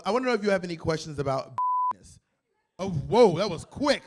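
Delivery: ordinary delivery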